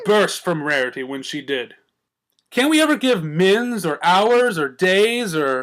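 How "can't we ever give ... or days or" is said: slow bubbly high whine; drawn-out giddy pony; Pinkie's voice. A narrator's reading. Pinkie rambling on the joke, bubbly and slow.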